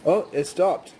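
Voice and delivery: ordinary talk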